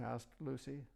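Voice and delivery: usual speaking voice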